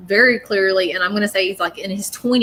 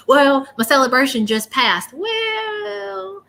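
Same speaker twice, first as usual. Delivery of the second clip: higher, drawn-out high 'well'; grandfather's voice; 6 semitones higher, much slower than usual. Retold or imitated. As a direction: imitate a folksy grandpa, ending on a long high note. Relaying the grandfather spirit's words.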